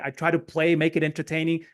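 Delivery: normal talking voice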